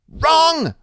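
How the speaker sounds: put-on sharp, high and loud little boy's retort; fierce. A narrator's reading. Boy correcting his friend at the storm drain, fierce and high. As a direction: snap it at him, fierce and high. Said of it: "real fierce".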